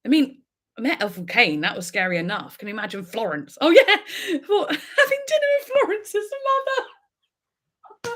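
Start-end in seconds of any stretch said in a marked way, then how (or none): 3.6-8.2 high laughing through the words; giggling at the idea. Real, not acted. Imagining dinner with Florence's mother, laughing. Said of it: "laughing at her own fantasy".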